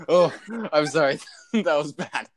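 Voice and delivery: laughing helplessly through words; can't stop laughing, gasping; hysterical laugh. Real, not acted. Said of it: "Why are you laughing so hard?".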